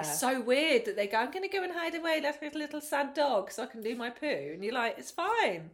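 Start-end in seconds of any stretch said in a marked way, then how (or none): 1.2-4.2 put-on high-pitched little-kid voice; imitated small child. Voices kids wanting to hide away. 5.0-5.7 high-pitched parental reassurance; mock reassuring parent. Voices parent reassuring the child.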